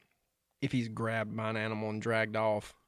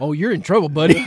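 loud, raised retelling of a warning; mock dad warning; 5 semitones higher, 12 dB louder than usual. Dad warning his son who hid in the store, loud and ominous. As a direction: belt it out as a laughing threat. Dad's retort to hidden son.

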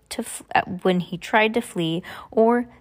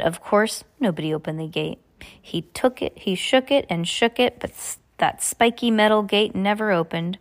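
ordinary delivery

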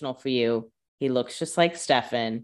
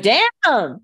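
mock shocked friends; 11 semitones higher, 7 dB louder than usual. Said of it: "And they're like".

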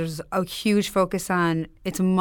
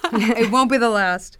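said through a laugh, higher and louder; 3 semitones higher, 4 dB louder than usual. Real, not acted. Guest joking back to host about the analogy, laughing. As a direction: laugh out a teasing comeback.

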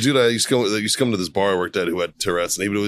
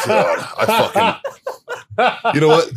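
laughing through the words; cracking up mid-sentence; breaking into laughter while ranting; 11 semitones higher, 3 dB louder, much slower than usual. Real, not acted. Laughing outburst about David.

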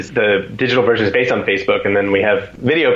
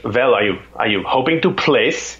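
put-on German accent; 2 semitones lower than usual. German pro sizing up a rival at the race, German accent. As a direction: imitate a German's careful question. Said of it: "it's this German accent".

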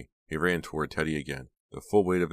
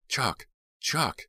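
calling out; 10 semitones higher than usual. A narrator's reading. Dina calling to her brother as she runs, alarmed shout. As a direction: call after him in alarm. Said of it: "Dina repeated his name as she ran".

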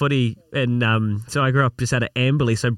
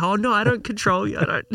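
higher, mock-innocent protest; mock partner protesting; 8 semitones higher than usual. Partners denying they run things, higher mock-innocent voice. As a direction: play the partner who swears she's not in control.

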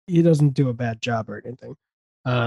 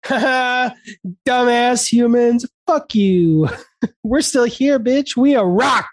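gloating animals; 8 semitones higher, slower than usual. Pretend. Surviving animals taunt dumbass humans, high and gloating. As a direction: jeer at the humans like smug animals. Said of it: "all the ants and deer ... gonna be like".

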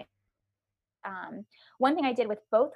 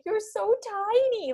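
tender wonder; 12 semitones higher, 4 dB louder, slower than usual. Retold or imitated. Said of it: "I remember being like".

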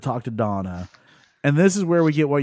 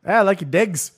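higher, playful Cockney imitation; imitated accent; 6 semitones higher than usual. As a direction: put on a mock Cockney lilt. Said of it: "I was like".

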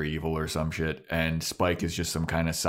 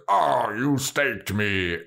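vampire; 3 semitones higher, much slower than usual. Retold or imitated. Vampire's dying line, retold scene.

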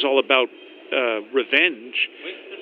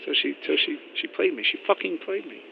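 stunned hush; 7 dB quieter than usual. Acted. Realizing she was used.

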